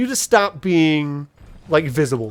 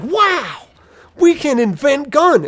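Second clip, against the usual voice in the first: much higher, wide-eyed wonder; amazed orcs; 8 semitones higher, slower than usual. Retold or imitated. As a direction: gasp it like kids seeing fireworks.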